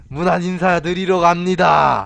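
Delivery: mock formal shout. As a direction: bellow it like a herald. Mock-formal call to boss mother.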